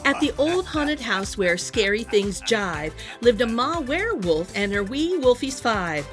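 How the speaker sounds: ordinary delivery, nothing marked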